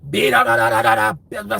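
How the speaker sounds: low, loud mocking babble; mock yelling gibberish. Speaker denying they yelled back at someone, mock yelling gibberish. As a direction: rattle off loud nonsense in a mocking voice. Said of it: "I didn't say".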